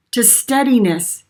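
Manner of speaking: normal talking voice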